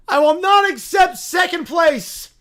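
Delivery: high, loud, slow proclamation; fanatic bear shout. Acted. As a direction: bellow it like a zealot bear prophet. Bear character declares.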